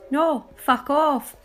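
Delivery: slow, drawn-out brush-off; mock-polite refusal. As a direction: turn them down with exaggerated politeness. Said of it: "To which I politely replied".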